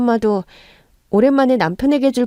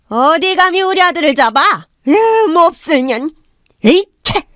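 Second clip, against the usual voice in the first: higher, louder spiteful jeer, ending in a spit sound; mother-in-law jeers at daughter-in-law, then spits; contemptuous sneer; 7 semitones higher, 5 dB louder than usual. Acted. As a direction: spit the insult out with disgust.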